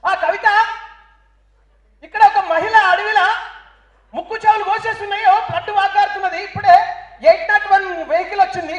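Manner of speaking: high, loud breathless reporter on the scene; mock TV reporter. Reporter breathlessly reporting from the forest scene, high and loud. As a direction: report it like an excited news reporter.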